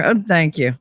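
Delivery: normal talking voice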